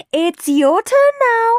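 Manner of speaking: high, loud, slow nurse voice; insistent nurse. A narrator's reading. Nurse Needle tells Henry it's his turn, high and insistent. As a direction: draw it out, brooking no argument. Nurse summons reluctant patient.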